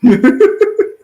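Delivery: a high, loud real laugh bursting out; loud burst of laughter. Real, not acted. Host cracking up at the Rob Roy joke, loud laughter.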